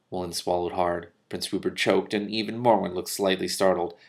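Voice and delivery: ordinary delivery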